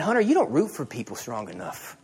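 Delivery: low, soft rebuke; hushed, inner conviction; a quiet voice on the heart; God's quiet word. Retold or imitated. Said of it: "God put that on my heart".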